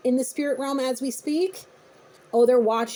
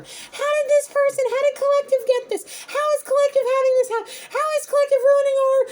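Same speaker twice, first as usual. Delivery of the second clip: much higher, louder whiny wailing; tantrum voice; 5 dB louder than usual. Retold or imitated. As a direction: whine like a furious spoiled child. Voicing the tantrum person.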